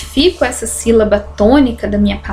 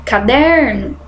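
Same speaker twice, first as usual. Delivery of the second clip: "Caderno" with DER stretched like a call; mock call, long DER in "Caderno"; calling out the word; slower than usual. Shown as a demonstration. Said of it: "pronunciam com mais força".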